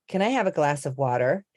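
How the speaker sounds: water with an American tapped t and r; American accent. Shown as a demonstration. Accent coach showing how a sentence changes by accent, American accent. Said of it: "if I was to say".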